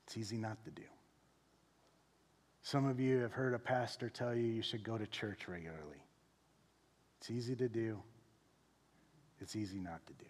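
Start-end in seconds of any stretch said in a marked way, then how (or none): 0.1-0.9 much softer. Shown as a demonstration. Pastor softly warning the congregation. Contrasting doing and not doing. 9.4-10.3 whispered. Shown as a demonstration. Pastor whispering to the congregation how easy neglect is. Contrasting doing and not doing.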